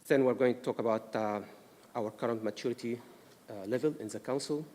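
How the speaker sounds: ordinary delivery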